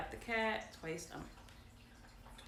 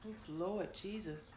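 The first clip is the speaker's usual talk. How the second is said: usual speaking voice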